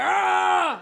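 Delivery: loud primal yell from the gut; barbaric roar. Retold or imitated. Comic urges men to roar like beasts, loud yell. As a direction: let out a caveman roar. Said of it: "from the balls and just be like".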